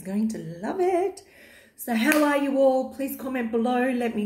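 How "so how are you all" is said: normal talking voice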